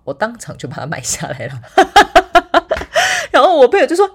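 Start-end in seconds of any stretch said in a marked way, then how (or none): 1.7-3.3 loud, high burst of laughter; delighted laughing burst; big laugh. Real, not acted. Laughs after buying the glasses.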